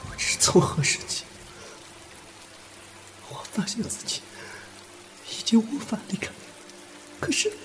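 high, breathy, heartbroken voice; tearful confession. Acted. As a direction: confess hopeless love between gasping breaths. (带着哭腔伤心告白)

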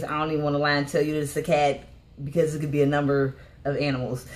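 ordinary talk

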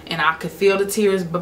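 ordinary talk

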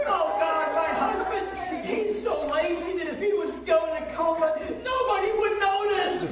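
loud, high exasperated wife act; mock complaining prayer. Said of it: "Those are complaints".

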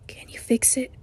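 hushed, breathy whisper of a question. A narrator's reading. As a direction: ask it in a soft whisper. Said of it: "he whispers".

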